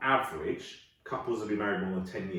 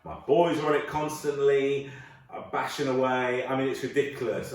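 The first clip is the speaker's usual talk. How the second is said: ordinary talk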